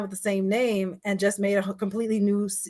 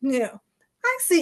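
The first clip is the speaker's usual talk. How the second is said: a light Bahamian lilt, higher pitched; imitating an aunt's Bahamian accent; 5 semitones higher, 6 dB louder than usual. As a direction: Bahamian aunt, lightly voiced. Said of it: "it's not like a Jamaican accent".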